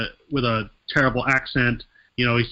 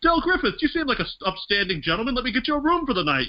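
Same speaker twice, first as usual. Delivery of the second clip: high, mock-courteous innkeeper voice, faster toward the end; polite motel owner; 13 semitones higher than usual. Podcaster imitating the motel owner greeting a guest, high and polite. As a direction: play the proper, upstanding motel owner. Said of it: "his dad, who's just sort of like".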